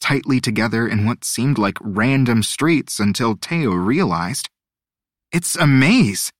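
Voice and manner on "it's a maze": slow, higher, amazed exclamation; sudden realization. A narrator's reading. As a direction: realize it with wonder.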